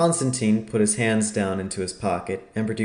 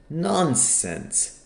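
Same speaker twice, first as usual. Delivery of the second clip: higher-pitched cry of disbelief; scoffing exclamation, pitched up; exclaimed dismissal; 7 semitones higher than usual. A narrator's reading. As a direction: exclaim it high, without raising the volume. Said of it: "cried Vidocq".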